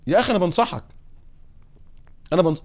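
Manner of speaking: usual speaking voice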